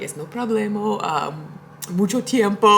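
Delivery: mock Spanish. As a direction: toss off the phrases in jokey tourist Spanish. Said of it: "I don't speak Spanish".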